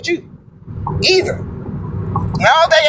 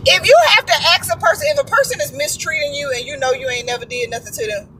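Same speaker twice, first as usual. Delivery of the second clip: normal talking voice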